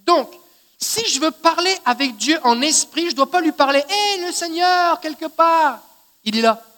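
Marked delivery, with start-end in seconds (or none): 3.9-5.8 higher, slow mock call into the distance; stretched-out call to a distant Lord; calling to a faraway God. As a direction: hail the Lord as if he were far off. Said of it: "c'est pas comme ça que je dois lui parler".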